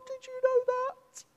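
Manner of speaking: high, breathless astonished question; amazed question. Retold or imitated. Woman at the bar amazed he knew the name, high. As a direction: ask it stunned.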